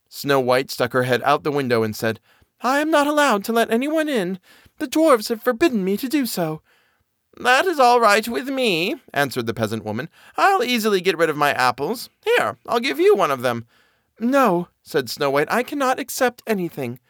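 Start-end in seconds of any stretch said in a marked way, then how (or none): 2.6-6.6 put-on high girlish voice; sweet young girl refusing. 7.4-9.0 put-on high cackly old woman; sly crone, playing nice; old peasant woman. 10.4-13.7 put-on sly old woman. Peasant woman voiced. 14.2-14.7 put-on high girlish voice; sweet young girl refusing. 15.5-17.0 put-on high girlish voice; sweet young girl refusing.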